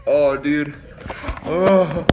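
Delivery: ordinary delivery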